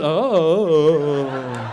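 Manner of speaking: long, vacant "oh"; spaced-out boy. Retold or imitated. Comic's son noticing nothing, dopey drawn-out oh. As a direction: let the oh trail on obliviously. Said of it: "boys get a little spaced out".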